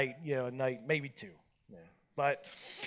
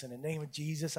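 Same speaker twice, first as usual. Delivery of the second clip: said nice; 5 semitones lower, 15 dB quieter than usual. Retold or imitated. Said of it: "I really did say this nice".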